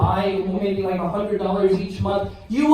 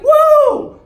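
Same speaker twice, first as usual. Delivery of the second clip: a high, loud pretend "Whoa!"; mock amazed exclamation; imagined shocked gasp; 9 dB louder than usual. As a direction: play the shock he hoped his wife would show. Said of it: "I thought she'd be so shocked".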